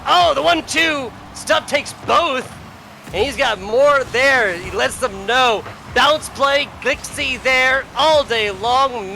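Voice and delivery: high, punchy esports commentary; excited play-by-play. Real, not acted. Caster following quick demos and bounce plays, excited play-by-play. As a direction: burst in high and keep calling the action.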